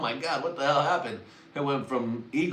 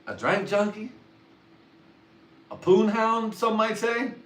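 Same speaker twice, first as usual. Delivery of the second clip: much higher, coy and teasing; coy falsetto; 12 semitones higher, 6 dB louder than usual. Pretend. Naming himself a poon hound, coy and much higher. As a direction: toss it off in a silly high voice. Coy self-labels as punchline.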